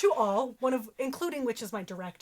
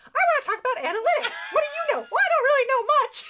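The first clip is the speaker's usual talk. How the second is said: high, loud silly puppet voice; 13 semitones higher, 7 dB louder than usual. Retold or imitated. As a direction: play a goofy hand puppet. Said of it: "and I'm like".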